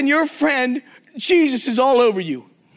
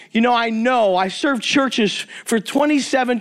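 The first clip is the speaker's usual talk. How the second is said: usual speaking voice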